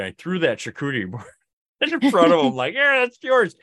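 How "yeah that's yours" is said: much higher mock offer; teasing high call. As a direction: shove it over, teasing. Tossing board to Doc.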